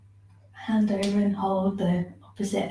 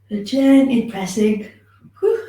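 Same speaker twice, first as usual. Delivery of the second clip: louder, yawning through the words; 3 semitones higher, 7 dB louder than usual. Real, not acted. Teacher apologises to class, yawning through words. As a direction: yawn it out then apologise. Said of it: "I yawn my head off".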